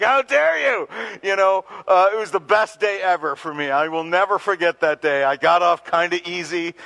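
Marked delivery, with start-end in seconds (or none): 0.0-0.9 loud, high indignant shout; loud mock-mom outrage; mom's anger. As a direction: scold like an outraged mother.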